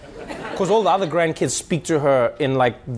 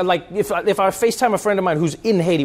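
usual speaking voice

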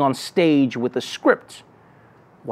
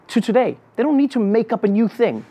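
usual speaking voice

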